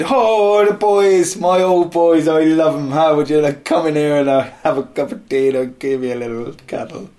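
high, loud gushing Irish welcome, easing down; hammed-up doting Irish accent. Ireland welcoming its own boys home, gushing Irish accent. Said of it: "In Ireland, they said".

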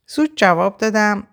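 ordinary delivery

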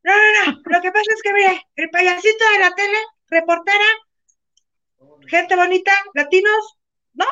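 high, pleading rush of excuses to the policeman; talking fast to calm a cop, nervous and pleading; frantic pleading. Retold or imitated. Talking her way out with a hotel cop, high and frantic. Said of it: "y yo".

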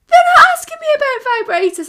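high, loud, fast protest; high-pitched mock son protest. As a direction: blurt the denial, high and loud. Son denies, mum retells.

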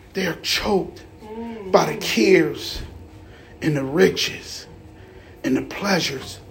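very slow, measured, with long pauses; scripture reading. A narrator's reading. Reads scripture aloud to congregation.

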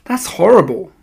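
put-on appalled young narrator's outburst; crying out. A narrator's reading. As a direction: cry out in horror. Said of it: "I cried".

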